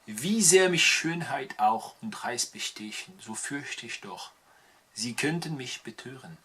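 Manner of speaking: slow, drawn-out German verse; slow German poetry recital; German poem recital. Acted. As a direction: improvise the sonnet in German, slowly. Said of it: "je vais l'improviser en allemand".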